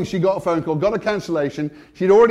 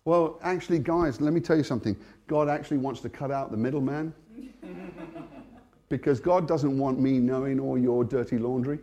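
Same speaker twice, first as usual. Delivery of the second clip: usual speaking voice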